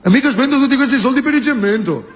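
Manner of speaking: high, fast stingy Genoese; tight-fisted Genoa mafioso; Genoese accent. Retold or imitated. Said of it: "A uno di Genova".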